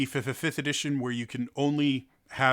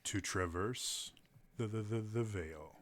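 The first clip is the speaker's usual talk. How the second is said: epic narration; 4 semitones lower, 10 dB quieter than usual. Shown as a demonstration. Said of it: "supposed to be all, uh, epic".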